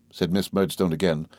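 plain reading voice

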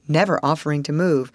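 ordinary delivery, nothing marked

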